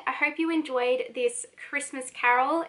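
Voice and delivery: ordinary talk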